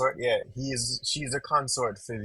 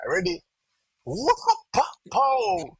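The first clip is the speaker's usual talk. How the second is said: sound effects; 12 semitones higher, 4 dB louder, slower than usual. Retold or imitated. Acting out a sudden killing.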